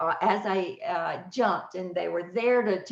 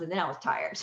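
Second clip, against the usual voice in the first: ordinary talk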